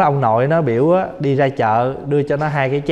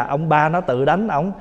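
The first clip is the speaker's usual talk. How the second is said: ordinary delivery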